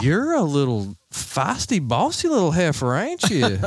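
sass in a put-on country woman's accent; country female voice. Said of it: "it's really country accent".

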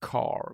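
"car" softly with a hard R; careful rhotic "car"; attempted American R. Shown as a demonstration. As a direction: practise "car" the American way, quietly. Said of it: "we go hard on the R".

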